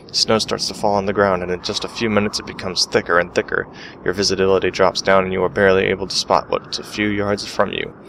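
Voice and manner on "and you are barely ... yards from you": ordinary talk, nothing marked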